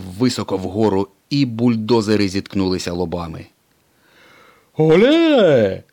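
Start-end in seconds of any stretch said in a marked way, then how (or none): 4.8-5.8 high, loud triumphant call; proud shout. A narrator's reading. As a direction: crow over the beaten bulldozers. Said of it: "вигукнув Дон Сирнико".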